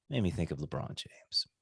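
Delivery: whispered aside. Real, not acted. Host whispering a punchline to his cohost, unvoiced. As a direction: lean in and whisper the name.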